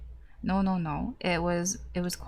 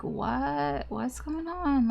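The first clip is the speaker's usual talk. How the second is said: higher, slow, half-asleep confusion; a lightly voiced groggy sleeper; dazed waking voice; 7 semitones higher, much slower than usual. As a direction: sound freshly woken and lost. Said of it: "really dazed and, like".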